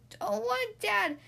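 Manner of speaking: put-on high, slow toddler wail; bellowing baby. A narrator's reading. Baby refuses to go to Dad, bellowing. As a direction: protest like a wailing toddler. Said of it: "bellowed the baby".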